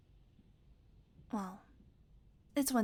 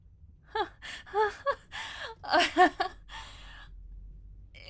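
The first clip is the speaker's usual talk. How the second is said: high, loud disbelieving laugh that turns toward crying; stunned laughter; 9 semitones higher, 6 dB louder than usual. Acted. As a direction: laugh in shock at the betrayal. Realizing who betrayed him.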